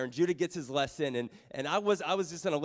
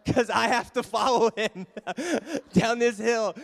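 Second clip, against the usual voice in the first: laughing through the words, higher and louder; 4 semitones higher, 4 dB louder than usual. Real, not acted. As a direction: laugh while telling it. Laughs remembering the fall.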